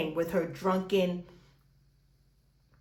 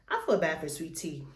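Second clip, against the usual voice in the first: ordinary talk, nothing marked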